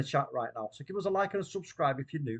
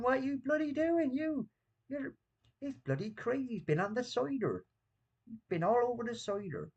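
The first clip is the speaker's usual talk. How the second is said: high, far-off Bristol farmer voice; a hammed-up Bristol accent, much higher; 9 semitones higher than usual. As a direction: squeak it out as an old West Country farmer in a Bristol accent. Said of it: "do the Bristol accent".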